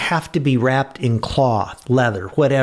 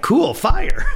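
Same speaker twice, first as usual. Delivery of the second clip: put-on higher, laughing "cool, fire" like a thrilled kid; mock excited guy; 11 semitones higher than usual. Preacher imitates Moses as a guy who loves fire, laughing excitedly. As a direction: play Moses as a guy delighted by flames, laughing. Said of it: "So he's like".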